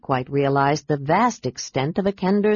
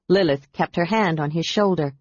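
plain reading voice

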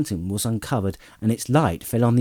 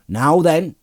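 loud, raised farmer's challenge; firm challenge; 7 semitones higher, 6 dB louder than usual. A narrator's reading. Farmer striding towards the rider, challenging. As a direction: stop a stranger in his tracks.

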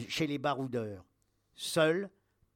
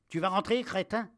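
high, loud, threatening question; angry insult; 5 semitones higher, 6 dB louder than usual. Acted. Captain, revolver on soldier's temple, angrily demanding. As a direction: demand obedience with open contempt.

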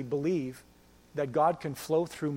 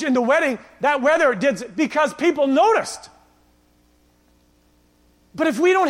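loud, driving sermon voice; fiery preacher building up; preaching at full force; 6 semitones higher, 8 dB louder than usual. Acted. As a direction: preach it hard to the room.

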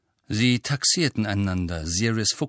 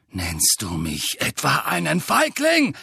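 roaring; 5 semitones higher than usual. A narrator's reading. Sirius challenging Snape across the kitchen, angry roar. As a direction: bellow it at him in fury. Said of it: "brüllte Sirius".

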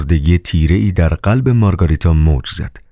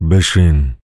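sudden command; slower than usual. A narrator's reading. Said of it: "ناگهان به مارگاریتا امر کرد".

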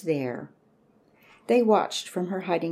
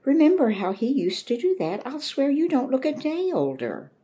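high and bright with delight, a bit louder; 10 semitones higher, 5 dB louder than usual. A narrator's reading. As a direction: beam with joy at the old dog. Mama delighted by the old dog.

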